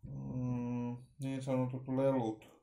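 ordinary talk

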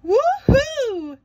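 very high, loud whoop; calling out. A narrator's reading. SpongeBob on a sugar high, loud high whoop. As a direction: holler with joy. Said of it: "SpongeBob called out".

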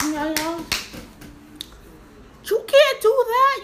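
mock shout, rising high; imitating a yell. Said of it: "she was like".

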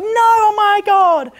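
loud, drawn-out falsetto wail; mock a girl's shriek, very high and slow; squealing girl impression. Speed-dating girl being crossed out, mock squeal. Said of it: "The girl's like".

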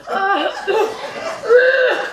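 loud, high gagging noise; mimed dry heaving. Retold or imitated. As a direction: act out retching. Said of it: "I'm starting to drive over".

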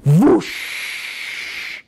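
breathy rushing whoosh; a blown whoosh; whoosh of fire. Retold or imitated. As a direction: voice the fire coming down. Fire falls from heaven.

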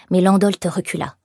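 ordinary delivery, nothing marked

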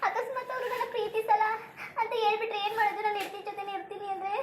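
talking through acted sobs; tearful. Woman confessing she cannot live without him, tearful and despairing. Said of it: "ಹಿಂಗೆ ಕಣ್ಣೀರು ಸುರಿಸ್ಕೊ ಕೂತ್ಕತಿಯಲ್ಲ".

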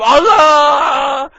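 long shouted war cry; battle cry. Pretend. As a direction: roar it as you charge.

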